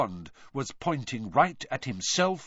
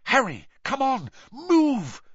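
high-pitched, pressing urgency; urgent plea; 12 semitones higher, 5 dB louder than usual. Acted. Hermione tugging Harry backwards, urgent plea. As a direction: urge him to flee at once. Frightened girl urging friend to flee.

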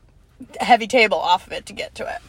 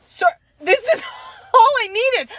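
exasperated mock shout; 12 semitones higher than usual. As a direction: blurt it out, fed up and laughing. Exasperated shout at IKEA worker.

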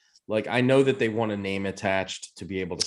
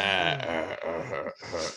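low, halting "uh-uh-uh" noise; badly faked low chuckle, fading off; failed laugh impersonation; 5 semitones lower, 4 dB quieter, slower than usual. Attempting Seth Rogen's annoying voice, low halting imitation. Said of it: "I can't even do a, an impersonation".

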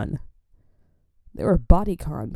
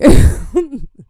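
loud burst of laughter; 7 semitones higher, 10 dB louder than usual. Real, not acted. Cracking up as she recalls dad calling her big, loud laugh. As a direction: cackle out loud, caught off guard. Laughing at dad's blunt answer.

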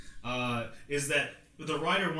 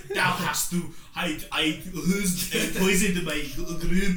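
mock olde Scottish; 4 semitones higher, 5 dB louder, slower than usual. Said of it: "it's in the ye olde Scottish".

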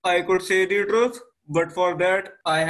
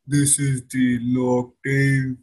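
much lower, slow deep voice; 6 semitones lower than usual. Shown as a demonstration. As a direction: drop the voice low and slow. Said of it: "I can modulate my voice".

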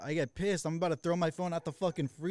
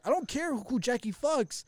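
indignant; 8 semitones higher than usual. Retold or imitated. Acted phone quarrel, indignant comeback.

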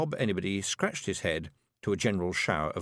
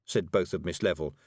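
ordinary delivery

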